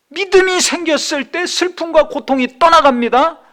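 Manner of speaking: loud, rising, impassioned preaching; preaching at full force. Acted. Pastor proclaiming to the congregation, loud impassioned preaching. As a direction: build to a triumphant cry.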